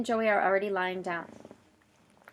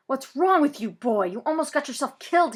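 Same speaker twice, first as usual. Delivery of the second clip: louder, higher angry scolding; mad; 6 semitones higher, 8 dB louder than usual. Acted. Policeman scolding Zach angrily. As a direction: tear into the reckless kid. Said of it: "He sounds mad".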